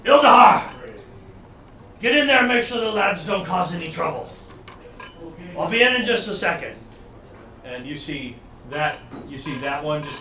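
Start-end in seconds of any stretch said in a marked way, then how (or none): 0.0-0.6 loud, high call of a name; yelling out. Acted. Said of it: "And he yells out". 2.0-6.8 raised, louder commanding voice; yelled order. Acted. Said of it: "actually he yells out".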